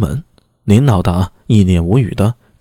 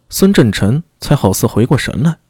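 ordinary delivery, nothing marked

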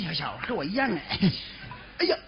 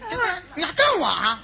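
ordinary delivery

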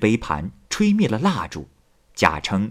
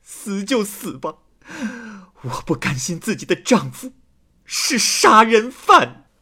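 defiant cry; 10 semitones higher than usual. A narrator's reading. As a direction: wail it high, then shout the last words. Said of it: "王七喊道". (绝望地高声哭喊，拖长了音)

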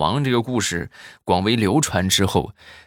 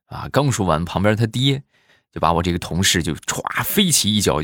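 normal talking voice

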